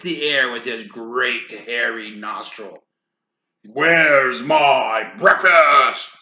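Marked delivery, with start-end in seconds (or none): 3.6-6.2 slow, loud roar. Acted. Hungry giant demanding breakfast, slow loud roar. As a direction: bellow for breakfast. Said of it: "he roared".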